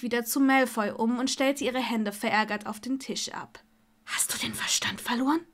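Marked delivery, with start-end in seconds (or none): 4.1-5.5 whispered disbelief; hushed, aghast question; incredulous whisper. Acted. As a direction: hiss the question under the breath. Stunned, covert question in public.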